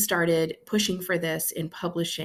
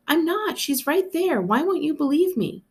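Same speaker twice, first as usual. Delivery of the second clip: put-on high, pleading child's voice; five-year-old pleading with her sister; upset little girl; 11 semitones higher, 3 dB louder than usual. A narrator's reading. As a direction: beg to be believed.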